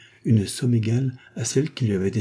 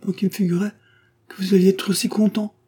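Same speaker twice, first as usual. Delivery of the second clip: high whimpering disappointment; sulky childish whine; whining; 12 semitones higher, 3 dB louder than usual. A narrator's reading. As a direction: Odette whines, hurt. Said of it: "pleurnicha Odette".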